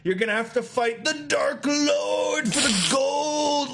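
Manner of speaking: high, drawn-out taunting overlord call; hammed-up fantasy villain dangling a prize, slowly. Orc overlord goading the player about gold, hammed-up taunting. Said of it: "when they're like".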